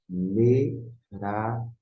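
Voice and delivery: "Merarefet" very slowly, me, ra, rasped he, fet; syllable-by-syllable pronunciation. Shown as a demonstration. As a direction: break "Merarefet" into spaced syllables. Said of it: "você vai pronunciar".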